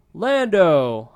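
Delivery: loud, happy call across the room; calling happily. A narrator's reading. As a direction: greet an old friend by calling his name. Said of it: "Han calls happily".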